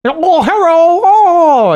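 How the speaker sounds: very high, loud put-on squeal; high cartoonish mock voice. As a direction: shriek it in a hammed-up caricature voice.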